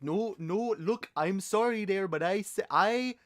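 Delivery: hammed-up polite Canadian protest; bad Canadian accent. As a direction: argue politely in a badly faked Canadian accent. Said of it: "do a good Canadian accent".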